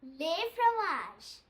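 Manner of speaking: "Le fromage" said slowly, each syllable clear; slow model word. Shown as a demonstration. Teacher leading the class in repeating, slow and clear. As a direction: draw out "Le fromage" for learners. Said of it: "Practice with me".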